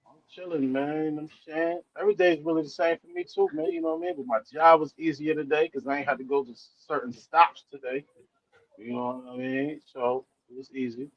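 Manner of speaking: quiet and muffled, far from the mic. Real, not acted. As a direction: sounds far away. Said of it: "You sound muffled".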